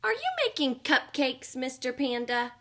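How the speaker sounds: high, eager little bunny asking the panda; a small bunny peeking at the baking, pitched high. Acted. Bunny asks Mister Panda about cupcakes, high eager voice.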